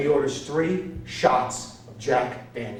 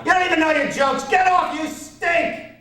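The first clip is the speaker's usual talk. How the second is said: heckler yell; 13 semitones higher, 5 dB louder than usual. Retold or imitated. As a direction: drag out get off. Heckler yelling.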